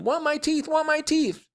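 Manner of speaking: put-on very high toddler wail; screaming. A narrator's reading. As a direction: shriek it like a small child demanding. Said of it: "Fudge started to scream".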